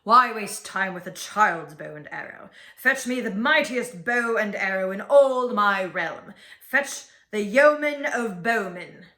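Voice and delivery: commanding king. A narrator's reading. King orders louder bow.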